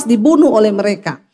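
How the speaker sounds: ordinary delivery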